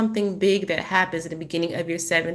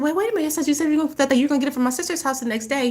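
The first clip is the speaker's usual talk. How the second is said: retold protest; 8 semitones higher, much faster than usual. Mother protesting to her ex at the door, high and rushed. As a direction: pitch jumps well higher, rushed at the end.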